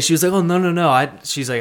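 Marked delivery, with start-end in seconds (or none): 0.3-0.9 high, quick innocent denial; sweet old lady voice. Retold or imitated. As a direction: deny it sweetly. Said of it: "lady's denial imitated".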